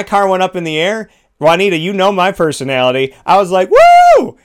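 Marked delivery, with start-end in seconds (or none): 3.7-4.4 high, loud joyful whoop; thrilled whoop. Retold or imitated. Reliving the car jump, a loud thrilled whoop. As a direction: let out a wild whoop of joy. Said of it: "You know, I was loving that".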